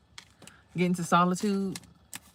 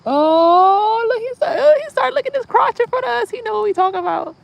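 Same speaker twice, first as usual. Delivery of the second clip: much higher, louder squealing laugh; excited squeal; 9 dB louder than usual. Real, not acted. Vlogger squealing as the squirrel eyes his crotch, excited high squeal. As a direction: shriek with delight at the squirrel.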